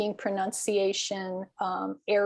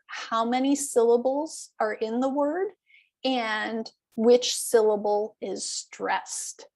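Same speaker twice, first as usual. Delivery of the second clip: usual speaking voice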